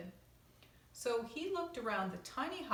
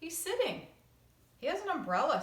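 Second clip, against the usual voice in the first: put-on higher, louder child voice; excited boy; 9 semitones higher, 6 dB louder than usual. Carl telling Michael what the bear does, eager. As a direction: share the odd detail eagerly.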